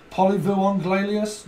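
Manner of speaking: slow, loud, high mock-French; French accent. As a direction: stretch out a comic French accent. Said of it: "It's a French accent".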